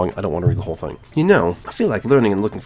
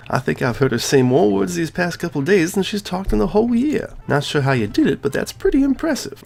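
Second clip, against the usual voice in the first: much higher, teasing club president voice; Monika voice; 8 semitones higher than usual. Acted. Gamer voicing Monika teasing the player about Yuri. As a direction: play her as a knowing, teasing schoolgirl.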